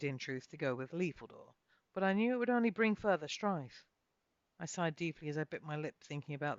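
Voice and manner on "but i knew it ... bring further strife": ordinary delivery, nothing marked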